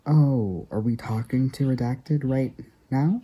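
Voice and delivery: high, bright mock surprise; oh, it's you?; surprised realization. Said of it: "And they're like".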